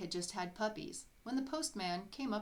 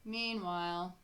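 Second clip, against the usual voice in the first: ordinary delivery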